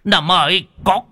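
high, stammering alarm; frightened high-pitched cry for help; panicked calling out. A narrator's reading. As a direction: call out in rising terror at a flying demon. Panicked calling to companion about demon.

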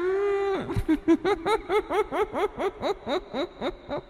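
high soft rising ghostly laugh; a dead girl's cackle trailing up; eerie ghost laugh. Acted. Ghost girl mocking Huyễn, high eerie laugh.

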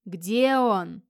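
loud, long-drawn eerie wail; loud drawn-out howling call; monster's howl. Acted. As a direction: howl it like a beast outside, stretching the vowel. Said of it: "опять завыла на дворе".

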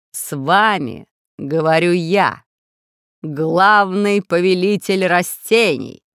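slow, loud, stretched-out pronouncement; villain ruler. A narrator's reading. Plant ruler introduces himself to intruders, slow grand villain. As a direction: announce yourself grandly, dragging every word. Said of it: "ответил голос".

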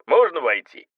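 muffled voice from a space helmet; echoing voice inside helmet. Acted. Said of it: "голос из-под шлема".

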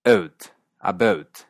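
"Out, about" with an E-like start, slow; E-like Canadian. Shown as a demonstration. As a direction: say "Out, about" almost as "et, abet". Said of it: "suena más como una E". ("out" casi con E)

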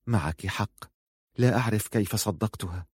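very soft, confiding undertone; hushed reply. A narrator's reading. Mother replying to her daughter's whisper, hushed. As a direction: answer under your breath.

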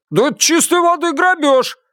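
very high-pitched outraged protest; proprietor's squawk of outrage; indignant. A narrator's reading. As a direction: cry robbery in a high voice. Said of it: "возмутился хозяин".

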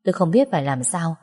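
ordinary delivery, nothing marked

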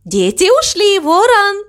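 high, eager call to a friend; excited call. Acted. Mama Mu tells crow the children left, calling high and eagerly.

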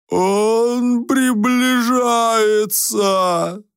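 very slow, high drawn-out moaning lion voice; a long, moaning talking lion; lion's moan. Acted. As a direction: moan the words out like a weary beast. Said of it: "голосом, похожим на протяжный стон".

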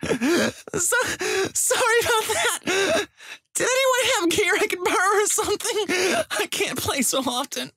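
very high, tearful falsetto; sobbing female voice. Pretend. Gamer faking a girl begging for gear in voice chat, sobbing. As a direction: sob through it as a put-on girl. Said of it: "Talk with a sobbing female voice".

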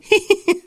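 high-pitched gleeful giggle; short delighted villain's titter; gleeful snicker. A narrator's reading. Vice-principal delighted to catch cheaters, high gleeful snicker. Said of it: "Нира в восторге заухмылялся".

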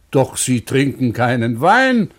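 ordinary delivery

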